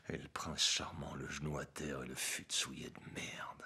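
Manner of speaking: low and soft, with long pauses; hushed, grim recounting. Acted. Ex-cop recalls the standoff with the gun, hushed and grim. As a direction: let the menace sit in a quiet, husky murmur.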